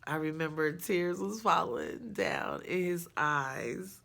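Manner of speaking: usual speaking voice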